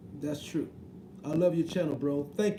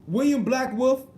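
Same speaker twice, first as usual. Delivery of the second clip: loud, higher call of the name; 6 semitones higher, 6 dB louder than usual. Real, not acted. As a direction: shout his name to get his attention. Calling out viewer again.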